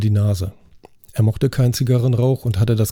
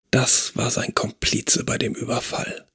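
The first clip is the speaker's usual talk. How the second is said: geflüstert; 6 dB quieter than usual. Acted. Elaine flehentlich zum Staatsanwalt.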